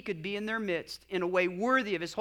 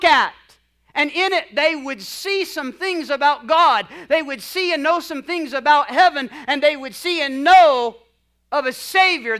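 loud, raised, emphatic preacher's voice; forceful preaching; 5 semitones higher, 11 dB louder than usual. Real, not acted. Preacher explaining God's pattern to congregation, forceful preaching. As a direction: drive each phrase out to the whole room.

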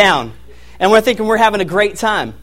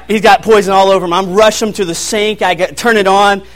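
ordinary delivery

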